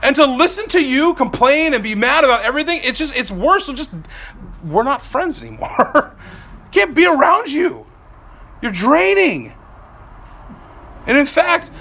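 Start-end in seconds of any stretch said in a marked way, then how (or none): 0.0-9.5 exasperated rant. Retold or imitated. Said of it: "that I'm like".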